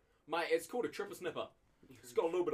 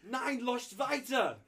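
put-on German accent; 5 semitones higher, 3 dB louder, much slower than usual. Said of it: "You did not do German accent?".

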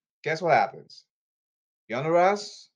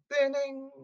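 mock bell chime; 12 semitones higher than usual.

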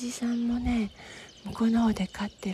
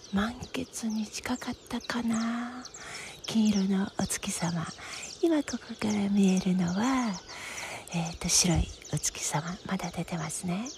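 whispering. Real, not acted. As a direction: whisper so nobody wakes.

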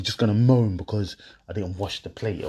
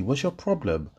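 ordinary talk

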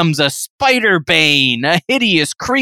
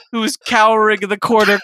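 ordinary delivery

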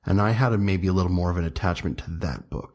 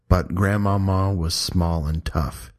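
ordinary delivery

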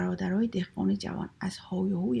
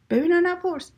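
high, loud storybook retort; emphatic acted command; loud curt refrain; 9 semitones higher, 7 dB louder than usual. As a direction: call it out as a firm brush-off. Brothers' chorus refrain.

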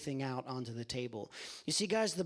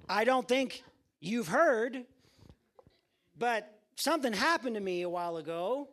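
amused Lazarus; 5 semitones higher, 5 dB louder than usual. Acted. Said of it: "Lazarus chuckles, says".

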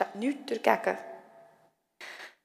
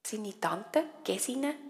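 ordinary delivery

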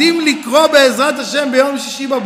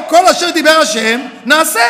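louder, rising proof; mock triumphant shout; 3 semitones higher, 7 dB louder than usual. Student proving his point to the rabbi, mock shout. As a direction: slam the verse down.

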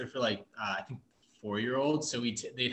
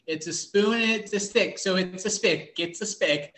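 put-on higher, singled-out phrases like a kid reasoning; imitated kid; 7 semitones higher than usual. Kid down the bench naming the utensil, imitated high kid voice. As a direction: mimic a child working out a word, pitched up. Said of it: "one of the kids was like".

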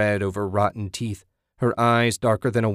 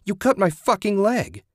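screaming; 11 semitones higher than usual. A narrator's reading. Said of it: "Susan screamed".

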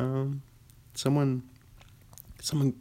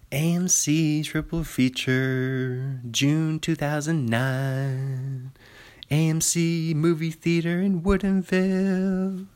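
slow, drawn-out movie-trailer announcer, rising higher at the end; 4 semitones higher, slower than usual. Pretend. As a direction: announce it like an epic trailer title. Announcer title read.

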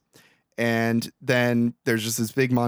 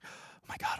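hushed, voiceless mock gasp of awe; awed whisper; 17 dB quieter than usual. Frank seeing his prediction come true, awed whisper. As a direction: whisper it as if stunned.

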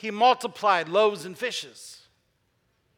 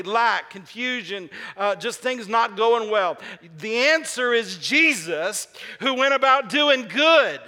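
preaching build-up; 2 semitones higher, 4 dB louder than usual. Acted. Sermon climax building.

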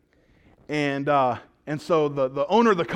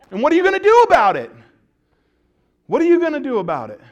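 loud, fast, sneering challenge; menacing taunt; 7 semitones higher, 8 dB louder, faster than usual. Acted. Centurion taunting a cheated neighbour, loud and fast. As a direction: dare them to try something.

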